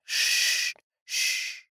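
soft breathy shushing sounds; whispered shh to silence him; hushing shh. A narrator's reading. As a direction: hush him gently, no voice. She hushes him, finger on lips.

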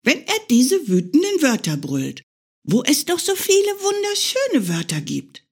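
high, wondering bunny voice; a small hare in love with words; dreamy little hare. Acted. Hare muses to himself, high and dreamy.

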